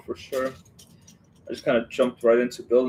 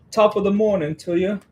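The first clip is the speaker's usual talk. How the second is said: high, lilting attempt at an English accent; badly faked English accent, pitched up; 8 semitones higher than usual. Streamer greeting a viewer, terrible English accent. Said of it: "My accent's terrible".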